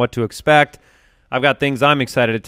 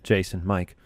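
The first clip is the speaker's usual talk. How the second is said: ordinary talk, nothing marked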